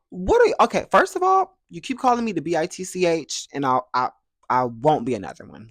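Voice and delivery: high, loud indignant push-back; heated. Retold or imitated. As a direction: snap back, fully fired up. Firing back at cursing uncle.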